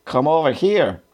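mild mock call in a German accent, pitched high; restrained high-pitched German-accented holler; German-accented shout. A narrator's reading. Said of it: "shouted in English with a strong German accent".

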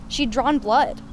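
ordinary delivery, nothing marked

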